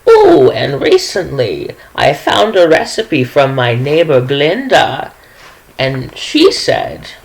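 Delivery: put-on high sweet old lady voice, gushing over her baking; a chatty granny showing off recipes; sweet old woman. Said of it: "she is like".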